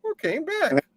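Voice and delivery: mock fear. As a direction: pretend to be scared. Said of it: "listener mock-dramatic".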